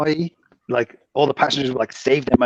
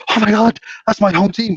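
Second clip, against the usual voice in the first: higher, louder, thrilled cry; excited stranger; 7 semitones higher, 4 dB louder than usual. Retold or imitated. Canadian stranger spotting his home team shirt, excited outburst. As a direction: play the stranger overjoyed at a hometown shirt. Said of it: "And he's like".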